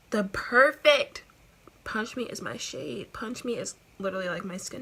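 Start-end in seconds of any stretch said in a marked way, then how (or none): 0.1-1.2 loud, slow gushing; excited gush. Real, not acted. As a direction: gush over the perfect shade. Gasp then gushing.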